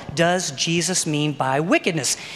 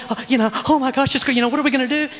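mock panic; 5 semitones higher, much faster than usual. Voices scared, fretting Christians.

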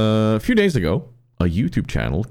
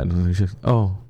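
usual speaking voice

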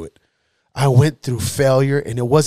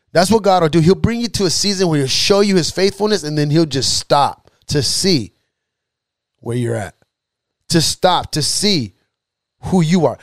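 raised-pitch, emphatic sermon; fervent preaching; 6 semitones higher than usual. Real, not acted. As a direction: drive the lesson home, pitch leaping on key phrases. Preaches to the congregation.

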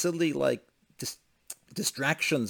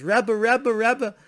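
high, loud acted calling; excited calling out; 10 semitones higher, 6 dB louder, much slower than usual. Father running after the Rebbe, excited calling. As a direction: call out as if running up to him.

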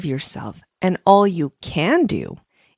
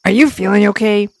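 concerned worm; 3 semitones higher, 6 dB louder, faster than usual. Acted. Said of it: "Worm asked with concern".

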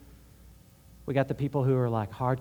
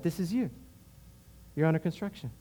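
soft and tender, a touch quieter; sweetly; 5 dB quieter than usual. Retold or imitated. God speaking gently to the doubting man, soft and tender. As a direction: gently tell him what he is, like a loving father. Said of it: "so sweetly".

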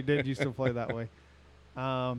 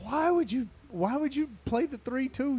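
exasperated quote; 12 semitones higher than usual. Retold or imitated. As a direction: whine it like a sore loser at the table. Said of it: "I'm like".

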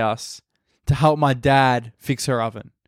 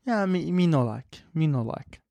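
soft imitation of Italian nonna's disapproval; lightly put-on Italian accent, quiet grumble; 2 semitones higher, 6 dB quieter than usual. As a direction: mutedly mimic broken-English Italian accent. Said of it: "Italian accent".

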